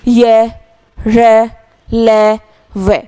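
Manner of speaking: य, र, ल, व each as a held, separate syllable; letter-sound demonstration. Shown as a demonstration. Teacher has students try pronouncing य, र, ल, व, demonstrating each sound separately. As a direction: sound out य, र, ल, व one by one, level and drawn out. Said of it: "जैसे अब उच्चारित करके देखो".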